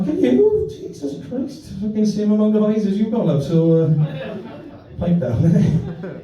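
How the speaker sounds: high, exasperated telling off; exasperated retort. Acted. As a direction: snap back, fed up. Ranting back at daughter.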